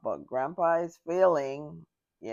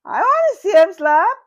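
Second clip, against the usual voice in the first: loud, much higher whoop, fast at the end; delighted shout, high and fast; excited yell; 14 dB louder than usual. Retold or imitated. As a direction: hype it up like a cheering teen. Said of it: "was like".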